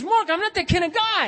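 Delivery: acting out an offended friend, high and loud; indignant protest. Friend asked if he'd rob a bank, indignant protest.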